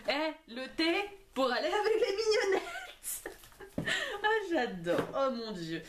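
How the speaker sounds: giddy, high-pitched delight breaking into laughter; hysterical laughing. Real, not acted. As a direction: laugh helplessly through the words. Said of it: "je suis hystérique".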